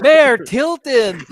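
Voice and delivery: loud, high, drawn-out mock exclamation; hammed-up quoted movie line; Calloway's correction. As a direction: stretch the name out indignantly. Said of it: "like Calloway says to the mayor".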